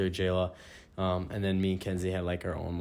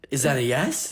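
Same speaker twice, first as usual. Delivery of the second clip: raised, hopeful question; louder and higher, hoping for a yes; eager question; 5 semitones higher, 5 dB louder than usual. Retold or imitated. Boyfriend asking his fiancée for her answer, eager and raised.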